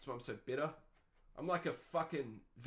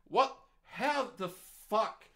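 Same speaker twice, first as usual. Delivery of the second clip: incredulous; 6 semitones higher, 5 dB louder, much slower than usual. Real, not acted. Disbelief at the article's advice.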